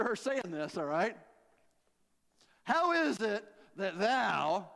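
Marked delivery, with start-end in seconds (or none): 2.6-4.7 drawn-out, indignant question; with attitude. Acted. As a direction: stretch it out with a bit of sass. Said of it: "saying with a little bit of attitude".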